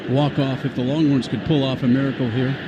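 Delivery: ordinary talk, nothing marked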